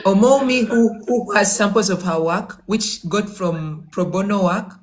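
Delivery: normal talking voice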